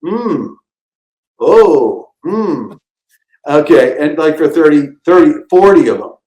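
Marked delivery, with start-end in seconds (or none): 0.0-2.8 mock tasting hum. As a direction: hum like you love the soup. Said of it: "thirty spoonfuls and you go".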